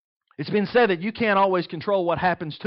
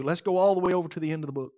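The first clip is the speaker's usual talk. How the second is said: rushed aside; 3 dB quieter, much faster than usual. Real, not acted. Flipping pages quickly.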